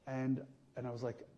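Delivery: normal talking voice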